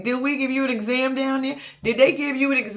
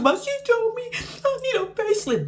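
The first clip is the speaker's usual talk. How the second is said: mock whiny crying; 11 semitones higher than usual. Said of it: "You wanna start crying to".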